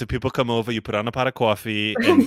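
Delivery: put-on lightly done Brooklyn mom accent, a bit fast; mock New York mom. Joking about Brooklyn family habits, light New York accent.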